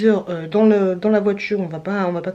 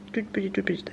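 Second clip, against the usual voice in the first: whispering; 9 dB quieter than usual. Real, not acted. Tender whisper over baby photo.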